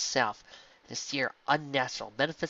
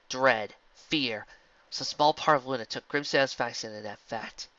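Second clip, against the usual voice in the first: ordinary delivery, nothing marked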